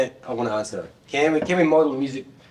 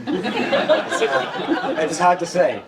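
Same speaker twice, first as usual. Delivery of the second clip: laughing; 4 semitones higher, much slower than usual. Real, not acted. Embarrassed boast of being a rock star.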